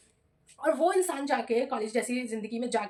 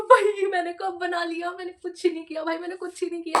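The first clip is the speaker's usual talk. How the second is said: exasperated protest; 4 semitones higher, 3 dB louder than usual. Retold or imitated. Student denying blame to teacher, exasperated protest. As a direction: plead innocence, stretching the words.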